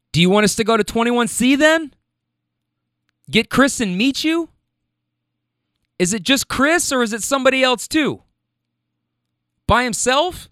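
lightly acted caller checking plans, voice lifted; man on phone. Friend coordinating loudly on the phone.

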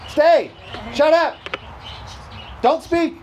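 loud, high, curt commands; animal-training commands. Acted. Preacher treats the devil like an animal, loud commands. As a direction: order an unruly animal around, loudly. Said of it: "treat the devil like you do animals".

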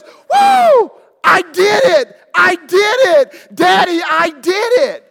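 screaming high and loud, I did it; child's triumphant yell. Retold or imitated. Daughter screaming her victory at the end, high triumphant yell. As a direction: shout like a thrilled little girl who finished. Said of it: "She goes".

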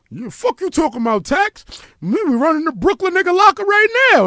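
high, excited retelling of the bunkmate; lightly voiced boastful inmate; bunkmate bragging. Retelling the jail bunkmate's brag about breaking the locker, excited and high. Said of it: "he was like".

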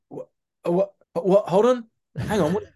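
put-on high, loud, baffled American voice, in a Californian accent; imitating a Californian AD. Floor AD questions the actor's accent, in a high Californian accent. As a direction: Californian accent, confused and pressing. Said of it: "I think she was from California".

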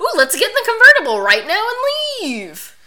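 loud, high-pitched overeager greeting; hammed-up nervous enthusiasm; flustered fake cheer. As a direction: put on a big, badly faked breezy act to hide a secret. Acting out Archie's flustered cover-up.

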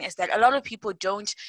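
usual speaking voice

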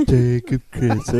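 slow, dopey Team America Matt Damon; Matt Damon puppet impression. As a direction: drag the name out dumbly. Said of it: "the Matt Damon thing from Team America".

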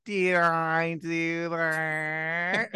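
long, drawn-out nerdy catchphrase; drawn-out Urkel voice; Urkel impression. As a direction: stretch it right out as a Steve Urkel gag. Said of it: "can you do your Urkel impression".

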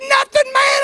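high, loud joyful shout; overjoyed shout. Retold or imitated. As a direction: burst out with joy. Retelling his own overjoyed call.

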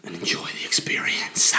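soft whispered close. Retold or imitated. Coaching himself before the show, in a whisper. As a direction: breathe the words out as a hushed aside. Ending his pep talk to himself.

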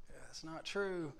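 drawn-out, pointed look-at-you line; mock-stern denial said slowly; slow deadpan denial. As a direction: say it slowly, like a silent stare. Silent look at his buddy.

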